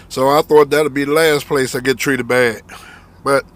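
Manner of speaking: ordinary delivery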